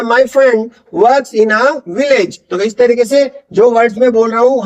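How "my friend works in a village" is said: very slow My friend works, clear F and V in village; F and V drill. Shown as a demonstration. Teacher for learners to repeat, slow My friend works in a village. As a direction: sound out friend and village deliberately. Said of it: "एफ की भी sound है".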